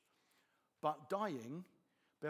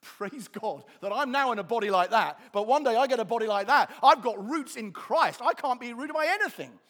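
loud, full-force preaching; ringing shout of faith; exultant praise; 4 semitones higher, 12 dB louder than usual. Acted. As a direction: proclaim it triumphantly, building higher. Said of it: "I'm looking at those flowers thinking".

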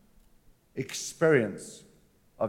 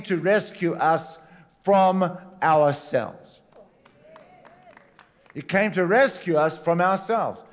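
preaching with force; 4 semitones higher, 3 dB louder than usual. Acted. Preaching build-up on the creator.

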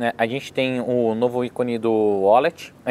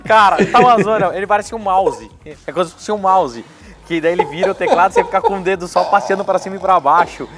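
laughing despair; 7 semitones higher, 3 dB louder than usual. Real, not acted. Laughing despair over keyboard.

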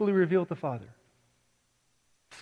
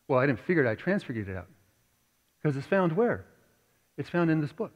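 ordinary talk

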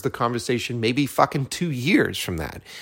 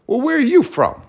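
a much higher, put-on voice for the bar stranger; curious stranger; 13 semitones higher than usual. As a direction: voice the nosy guy across the bar. Quoting the guy at bar.

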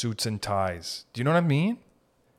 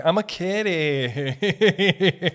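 high, loud, drawn-out kitty voice; cat voice; 6 semitones higher, 5 dB louder, much slower than usual. Acted. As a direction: stretch it out like a cutesy cat. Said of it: "every cat's voice is just like".

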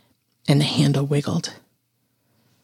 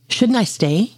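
higher-pitched, uncertain question; raised, querying tone; 6 semitones higher, slower than usual. A narrator's reading. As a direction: ask it lightly, voice lifted. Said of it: "you questioned".

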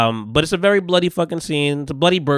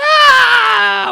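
very high loud screaming ah; labor scream; 8 dB louder than usual. Retold or imitated.

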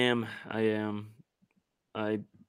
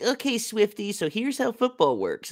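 condescending explainer; 9 semitones higher than usual. Retold or imitated. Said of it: "my favorite meme of that".

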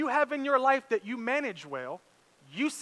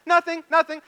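retold panicky denial; 8 semitones higher, 7 dB louder than usual. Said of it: "in a complete panic".

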